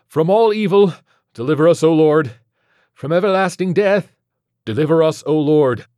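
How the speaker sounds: high, loud exorcism prayer; fervent, full-voiced liturgical shout; shouted litany. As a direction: cry out each petition forcefully. Exorcism litany shouted over carnage.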